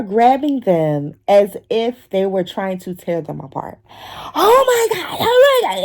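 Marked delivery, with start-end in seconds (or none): 4.3-5.8 put-on high excited kid shriek. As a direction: squeal over the toys. Kids excited grabbing toys.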